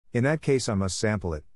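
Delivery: ordinary delivery, nothing marked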